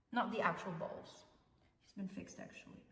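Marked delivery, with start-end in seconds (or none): none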